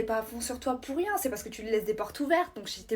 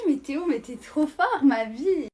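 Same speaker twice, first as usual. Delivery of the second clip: gushing praise; 3 semitones higher, 9 dB louder, slower than usual. Acted. Girl gushing over Théo scoring.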